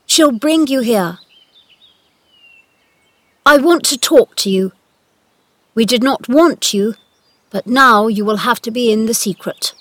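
loud, imperious instructions; commanding. A narrator's reading. Boy master giving the gardener orders, commanding. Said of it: "I'm your master".

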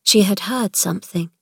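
plain reading voice

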